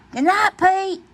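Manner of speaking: high bright goodnight call. Acted. The friends call goodnight to Pete brightly. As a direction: sing it out as the group.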